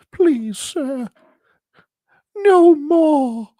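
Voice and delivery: tiny high-pitched begging voice; pleading wallet. Acted. Wallet begging its owner for mercy, pleading. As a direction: beg in a squeaky, whimpering falsetto. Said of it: "begging, begging and pleading".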